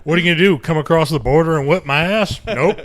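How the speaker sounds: loud jeering challenge; loud mocking dare; mock taunt. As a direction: taunt them, loud and cocky. Mock taunting a Canadian listener.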